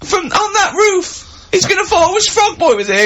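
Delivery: a hammed-up passer-by wailing for help; panicked bystander. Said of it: "people walk along and they go".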